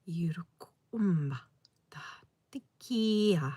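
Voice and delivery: slow, drawn-out sacred syllables; channelled love language. Acted. Healer calling forth sacred sounds for viewers, slow chanted invocation. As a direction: intone it slowly, the last word lifting high then falling.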